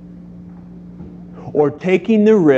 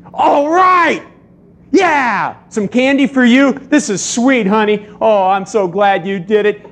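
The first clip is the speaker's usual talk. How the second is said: cheering parent; 7 semitones higher than usual. Retold or imitated. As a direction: cheer the toddler like a proud parent. Parent cheering potty success.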